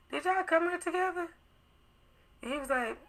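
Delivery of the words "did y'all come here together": high, casual retold question; trying to be cool. Asking him if they came together, trying to be cool. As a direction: float the question up, playing it cool. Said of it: "trying to be cool".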